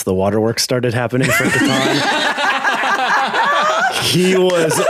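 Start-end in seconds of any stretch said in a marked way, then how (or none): 2.3-4.0 high-pitched burst of laughter. Real, not acted. Cracking up at a party member slipping away, high laugh. Group laughs at the failed roll.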